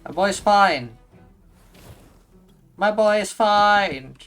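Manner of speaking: loud, insistent reassurance. Real, not acted. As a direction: insist loudly he's fine. Reassuring about his character.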